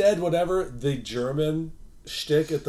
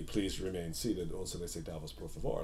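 ride announcer; 8 semitones lower, 12 dB quieter than usual. Retold or imitated. Announcer addresses stopped Matterhorn riders, low and measured. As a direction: imitate a recorded safety message. Mimics the ride's stop announcement.

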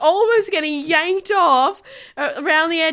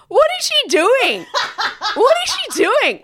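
put-on high, louder drunken bafflement; drunk man; 8 semitones higher, 3 dB louder than usual. Drunk husband gawks at a woman peeing, high baffled call. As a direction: play a baffled drunk bloke. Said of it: "the husband of the man was clearly very drunk".

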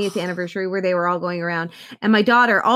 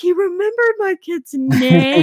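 amazed; 8 semitones higher, 4 dB louder, slower than usual. Retold or imitated. Mom's amazed reaction retold.

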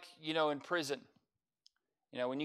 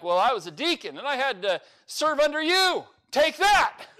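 mock boast; 9 semitones higher, 8 dB louder than usual. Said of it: "And you're like".

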